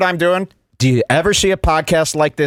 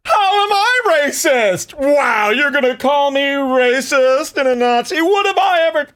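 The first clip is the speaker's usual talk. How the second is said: mock indignant protest; 11 semitones higher than usual. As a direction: imitate an outraged denial in a high, strained voice. Mimics Cumia denying he is racist.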